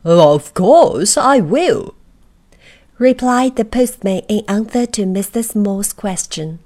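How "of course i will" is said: postman's voice. A narrator's reading. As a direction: boom it down like a big postman. Postman answers.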